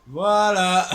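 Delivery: loud, high burst of "voilà"; loud exclamation. Real, not acted. Streamer summing up the bad result, loud exclamation.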